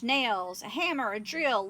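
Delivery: ordinary delivery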